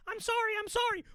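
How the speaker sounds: very high, pleading mouse squeak; Mickey. Acted. Mouse begs his angry roommate's pardon, high squeak. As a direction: plead for forgiveness as a cartoon mouse. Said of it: "Mickey's, like, secretly playing the Xbox".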